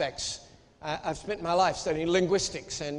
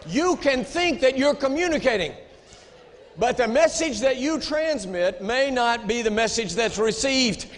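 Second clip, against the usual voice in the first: raised preacher's voice, higher; preaching; 4 semitones higher, 5 dB louder than usual. Acted. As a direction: proclaim it from the pulpit. Preaching at full force.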